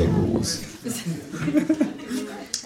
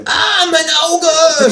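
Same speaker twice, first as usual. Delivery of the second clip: very high, drawn-out loud shriek; high-pitched kangaroo scream of pain; 7 dB louder, much slower than usual. A narrator's reading. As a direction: shriek slowly as if hurt in the eye. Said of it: "schreit das Känguru".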